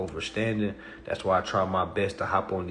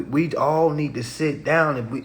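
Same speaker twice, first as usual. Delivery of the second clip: usual speaking voice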